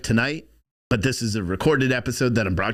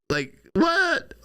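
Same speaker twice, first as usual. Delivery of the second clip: very high-pitched baffled "what"; incredulous. Acted. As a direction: squeak the question in disbelief. Baffled reaction to boot removal.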